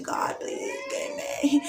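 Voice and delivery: very high, slow, pleading cry; mock desperate prayer. Praying for a baby, a hammed-up plea. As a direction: beg heaven dramatically.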